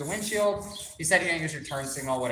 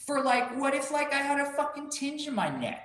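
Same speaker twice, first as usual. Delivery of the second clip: incredulous outrage; 10 semitones higher than usual. Retold or imitated. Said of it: "voicing outrage to officer".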